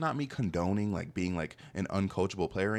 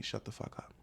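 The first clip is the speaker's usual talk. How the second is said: 1 hushed mock shutdown; 10 dB quieter than usual. Peers silencing him, quoted.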